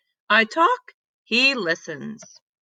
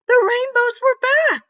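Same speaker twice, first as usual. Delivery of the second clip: excited; 5 dB louder than usual. Acted. Rainbows suddenly reappear.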